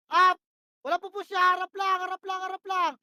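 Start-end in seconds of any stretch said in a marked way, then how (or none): 0.1-0.4 sharp loud call to the team; shouted call. Real, not acted. Shotcaller yelling to teammates, loud sharp call. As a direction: bark it out to your squad.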